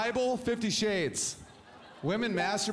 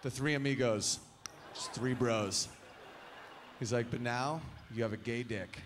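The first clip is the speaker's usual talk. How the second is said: bro buddy; 5 semitones lower, 3 dB quieter than usual. Retold or imitated. Buddy spelling out the scenario in a low bro voice. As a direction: lower bro voice, dropping softer. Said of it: "My buddy's like".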